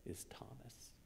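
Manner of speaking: dramatic hushed reveal; whisper. Acted. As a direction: whisper the name as the punchline. Sermon reveal of the climax.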